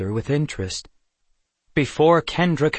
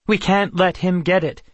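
higher, louder worried plea; urgent alarm; 6 semitones higher, 5 dB louder than usual. A narrator's reading. Kendra urging Ronadin as the enemy nears, urgent alarm. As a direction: press the point urgently.

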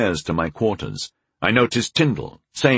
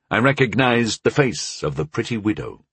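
ordinary delivery, nothing marked

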